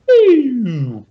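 high, loud, drawn-out boop; a long cartoon swoop up high; comic sound effect. Narrating Magneto pulling metal apart, comic sound effect. Imitates metal wrapping in retelling.

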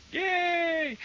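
gasp, then a very high-pitched yay; delighted cheer. Real, not acted. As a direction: squeal a little cheer at good news. Delighted at news of comeback.

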